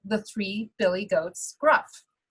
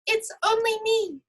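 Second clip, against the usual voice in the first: much higher, tiny billy goat voice; little goat voice; 12 semitones higher than usual. Acted. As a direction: squeak it out like the smallest goat. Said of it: "in his not very loud little voice".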